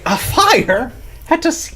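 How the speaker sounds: high, slow, incredulous Irish "A fire at a sea"; incredulous Irish accent. Shown as a demonstration. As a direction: ask it in disbelief with an Irish lilt. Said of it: "Dude, that is so good".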